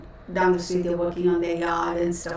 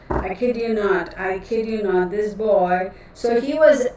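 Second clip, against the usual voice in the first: ordinary talk, nothing marked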